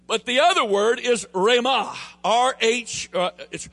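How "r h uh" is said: ordinary delivery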